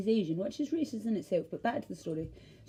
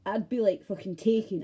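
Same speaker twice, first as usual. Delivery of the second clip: ordinary talk, nothing marked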